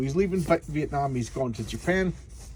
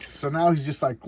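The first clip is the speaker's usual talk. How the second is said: ordinary talk, nothing marked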